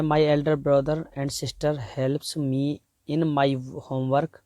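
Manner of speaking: reading the exam sentence out very slowly; slow dictation. Shown as a demonstration. Teacher reads the test sentence to students, very slowly. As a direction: spell out each word slowly for the class. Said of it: "पहला है".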